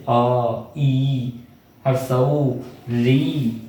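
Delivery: slow, with the vowel अ drawn out long; drawn-out vowel demo. Shown as a demonstration. Teacher showing students how to voice the vowel अ, slowly drawn out. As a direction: stretch the sound अ so the class hears it. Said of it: "जिनको बोलने में कम समय लगता है".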